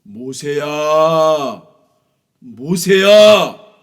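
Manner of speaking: very slow, loud booming call; God calling Moses. Acted. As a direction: call out slowly from far above. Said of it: "하나님께서 모세에게 나타나셨어요".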